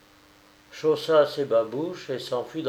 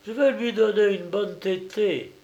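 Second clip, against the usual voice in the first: higher-pitched, impulsive cry of generosity; generous exclamation; 8 semitones higher than usual. A narrator's reading. Nursemaid carried away by natural generosity, exclaiming. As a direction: exclaim it on a kind impulse, without shouting. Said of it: "Anne s'écria".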